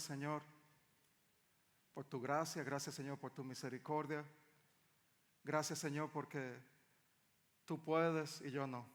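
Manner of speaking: ordinary delivery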